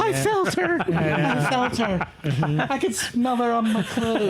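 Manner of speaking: high, breathy haunted mother from Poltergeist; hushed high ghost-struck awe, playfully; JoBeth Williams. Retold or imitated. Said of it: "my Joe Beth Williams and poltergeist impression".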